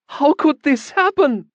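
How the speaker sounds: high, loud, slow shriek; a woman shrieking while she pummels the mage. A narrator's reading. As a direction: shriek it in feigned outrage. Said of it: "she shrieked".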